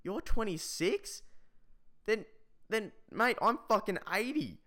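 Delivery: high-pitched mock disbelief with halting pauses; incredulous. Student replying to a classmate's claimed age, incredulous. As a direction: question it slowly, then retort in a high voice.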